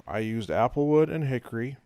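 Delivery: normal talking voice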